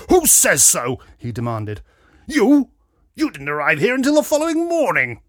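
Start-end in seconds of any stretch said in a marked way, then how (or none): 0.1-1.0 loud, high blustering splutter; splutter, then demand it indignantly; spluttering outrage. A narrator's reading. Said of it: "The Major spluttered". 2.3-5.2 very loud, high, blustering Major; spluttering outrage. A narrator's reading. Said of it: "The Major spluttered".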